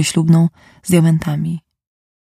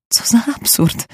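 thin, whispery, higher voice; a thin, breathy higher line in disbelief; 6 semitones higher than usual. Acted. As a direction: say it thinly, barely voiced. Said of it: "powiedziała cienkim głosem".

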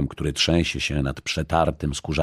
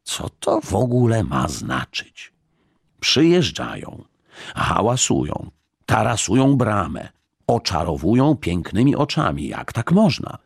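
outraged list building higher in pitch; cross, indignant grumbling; 5 semitones higher than usual. A narrator's reading. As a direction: fume through each complaint, rising to indignation. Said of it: "znowu był na wszystko zły". (oburzone, coraz wyższe zrzędzenie)

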